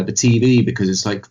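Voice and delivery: ordinary delivery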